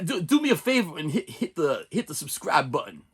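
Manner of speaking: halting, lightly done impression; a touch of actor mimicry, broken phrasing; Christopher Walken. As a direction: make the request as a mild celebrity impersonation. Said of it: "Was that more, Chris?".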